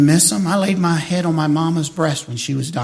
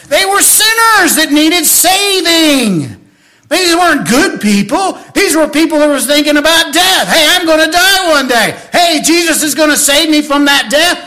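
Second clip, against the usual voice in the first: higher, louder preaching; preaching at full force; 6 semitones higher, 4 dB louder than usual. Acted. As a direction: drive it home to the crowd.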